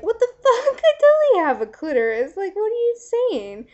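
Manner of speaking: high, loud indignant imitation; outraged freak-out. Friend snapping back at the guy, acted indignant yell. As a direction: shriek it in mock outrage, half laughing. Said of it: "freaking out".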